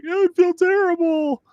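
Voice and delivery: put-on very high, whiny girl; little girl voice. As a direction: pipe up like a little sister. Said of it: "And my sister's like".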